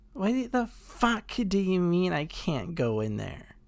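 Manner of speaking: high-pitched mock drunk indignation; drunken lady. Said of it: "there was this one drunken, somewhat tan lady".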